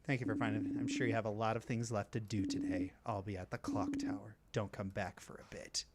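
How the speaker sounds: low, soft, curt brush-off; dismissive character voice. Acted. Game character sending the friend away, low and cold. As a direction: push the friend away, coldly.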